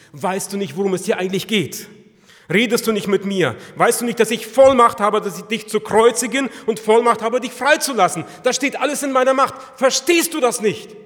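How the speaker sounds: loud, high, domineering demand; Pilate threatening. Acted. Pilate to silent Jesus, loud and domineering. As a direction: bear down with his authority.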